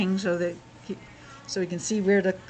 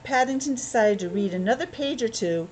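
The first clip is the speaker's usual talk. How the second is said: steady read-aloud storytelling voice; story narration; 3 semitones higher, 4 dB louder than usual. Acted. Parent reading Paddington to a child, storytelling voice.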